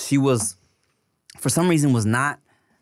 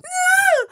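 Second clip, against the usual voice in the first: high, loud imitation of toddler's squeal; mock toddler squeal; 5 dB louder than usual. Dad mimics daughter as he grabs her arm, mock squeal. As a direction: squeal like a child pulling away. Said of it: "So now she's crying".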